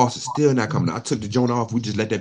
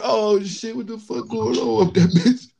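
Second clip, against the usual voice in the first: high, startled outburst; alarmed; slower than usual. Retold or imitated. Speaker reacting as the cartridge shoots out, startled. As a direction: react as the pipe blows. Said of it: "I'm like".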